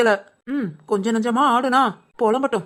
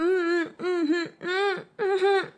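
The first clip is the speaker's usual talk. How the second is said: soft, high closed-mouth whimpers; muffled whimpering; 5 semitones higher, 6 dB quieter, much slower than usual. Acted. Trying to tell mother without words, muffled whimpering. Muffled noises after being told off.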